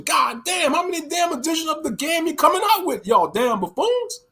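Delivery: shouted high, angry disbelief; outraged shout at game companies; exasperated rant. Real, not acted. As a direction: yell at the greedy publishers. Ranting at game publishers.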